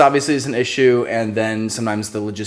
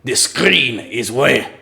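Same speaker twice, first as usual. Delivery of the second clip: put-on terrible French accent; much slower than usual. Joking to fans about colors, terrible French accent. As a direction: point and say it in a silly French accent. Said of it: "terrible French accent".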